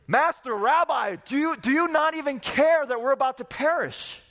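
high, loud cry of panic; panicked disciples. Acted. Disciples cry out to Jesus in the storm, panicked and high. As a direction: plead with the sleeping master, terrified.

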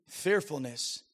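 ordinary talk

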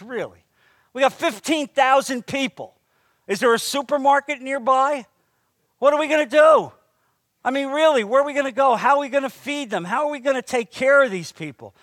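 high, loud, disbelieving questions; exasperated disciples. Retold or imitated. Disciples protesting to Jesus about feeding the crowd, exasperated and loud. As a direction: throw up your hands at the crowd size.

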